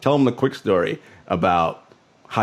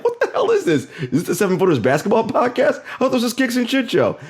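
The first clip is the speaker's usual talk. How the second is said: high-pitched, laughing mock outrage; baffled listener; 13 semitones higher than usual. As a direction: act out a confused listener, voice shooting up. Mimics confused listeners losing their minds.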